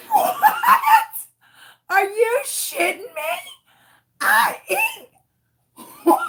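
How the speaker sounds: very high, squealing mock hysterics; hysterical disbelief. Customer hearing roaches get in food, hysterically shocked. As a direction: squeak it out, half laughing, half wailing. Retold shock at waitress's reply.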